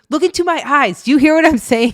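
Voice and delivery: high, loud imitation of an insistent parent; mock arguing parent. Kids mimicking their parents' fight, mock-insistent. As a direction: press each phrase in a mock-heated voice.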